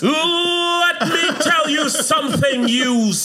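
hammed-up wrestling trash talk; wrestler promo. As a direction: drag it out like a ring villain.

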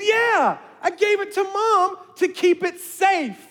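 put-on proud kid reporting; proud little girl. Daughter voiced, proud.

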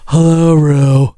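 mock man voice, low and drawn out; exaggerated slow, low manly greeting; Bosco. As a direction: drop it deep, slow and loud. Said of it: "she talked with a man voice".

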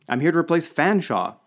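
high, loud, overly chipper announcement; chipper and triumphant. A narrator's reading. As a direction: announce it triumphantly, far too cheerily. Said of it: "excessively chipper and triumphant tones".

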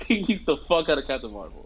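laughing through the repeat; amused retelling with a laugh. Real, not acted. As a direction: crack up as you say it again. Repeats line while laughing.

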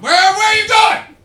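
very high, full-volume yell; shouting after someone. Real, not acted. Comedian to a woman leaving the show, high urgent shout. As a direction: holler to stop someone walking away. Calling after a departing audience member.

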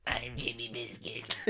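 faint eerie whisper from beyond; ghostly whisper. Pretend. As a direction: breathe it like a drowned ghost. Ghost voice from the canal.

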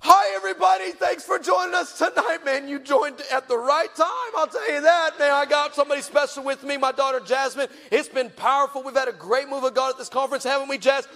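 high, bright on-air welcome; TV host voice. Retold or imitated. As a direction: greet viewers like a live host.

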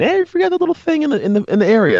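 high mocking voice of the nagging wife. Husband imitating wife's complaint in a high mock voice. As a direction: squeak it out like his wife complaining.

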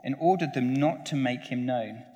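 usual speaking voice

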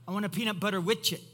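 put-on quick childlike "widget" as "witch-it" for sandwich; toddler mispronunciation. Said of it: "this is what they would say".

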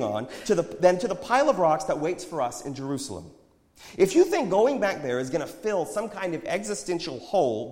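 loud, high, impassioned argument; heated retort. A narrator's reading. Prophet argues with his friend, shooting back. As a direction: fire back forcefully. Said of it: "the prophet shot back".

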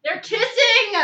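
cheering yell; excited shout. Real, not acted. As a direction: shout it out gleefully. Cheering at movie kiss.

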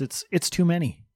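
normal talking voice

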